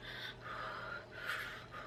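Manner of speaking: long, audible breaths blown out; panicked breathing. Retold or imitated. Patient on the operating table, panicked breathing. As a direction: imitate anxious deep breathing. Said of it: "I'm panicking and I'm breathing. I'm going".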